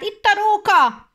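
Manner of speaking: loud, high, slow shout; loud shout that a fox is here. Acted. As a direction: yell out the alarm. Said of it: "elkiáltotta magát".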